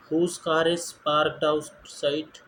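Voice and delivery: each word drawn out slowly for learners; slow, careful classroom reading; slow model question. Shown as a demonstration. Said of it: "teacher models the question slowly".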